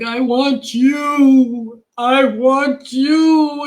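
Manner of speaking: put-on very slow, drawn-out child's plea; little boy on the phone. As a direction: stretch each word like a small kid begging. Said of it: "he's like".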